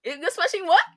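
high, loud shocked questioning; shouting. Real, not acted. Host loudly challenging co-host's slip about smashing, shouting. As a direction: shout it back in high-pitched disbelief. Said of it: "No, they shout now".

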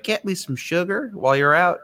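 high-pitched, sugary quote of a sweet woman; too-sweet woman. Retold or imitated. Host mimicking a doomed wife asking a favor, too sweet. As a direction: lilt it up high, imitating her sweetness. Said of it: "she's just too sweet".

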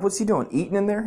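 put-on high-pitched childlike questions; puzzled little kid. Kid asking about uncle behind the door, puzzled high voice. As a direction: imitate a kid innocently wondering. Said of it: "I'm just like a little kid".

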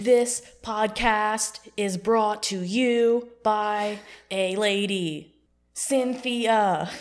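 very slow, loud slam-poet cadence; slam poetry. Pretend. Host redoes the podcast tagline, slam poetry. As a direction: punch each word out like a slam poet. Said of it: "should have done it slam poetry".

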